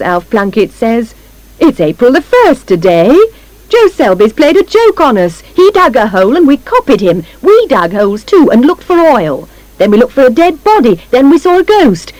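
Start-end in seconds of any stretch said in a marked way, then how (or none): 1.6-12.1 high, lively exclamations; raised, higher animated voice; animated recounting. A narrator's reading. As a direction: recount each folly with mounting exasperation. Man excitedly recounts how they were fooled.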